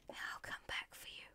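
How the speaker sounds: hushed tender-sounding whisper; whispered vow to come back; soft whisper. Pretend. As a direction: whisper a secret promise. Promise to lost animals.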